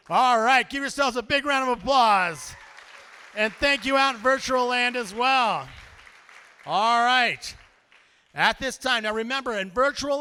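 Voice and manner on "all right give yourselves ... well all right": loud, raised-pitch crowd call; rousing shout. Real, not acted. Host thanking the room and virtual donors, rousing loud call. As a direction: whip up the applause.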